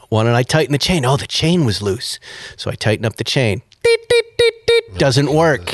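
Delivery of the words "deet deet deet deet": high electronic beeping; imitated alarm beeps. Garage door alarm going off, high mock beeps.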